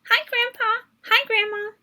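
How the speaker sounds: put-on high, bright child's hello; child greeting. A narrator's reading. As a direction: greet them like an eager little kid. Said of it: "I say".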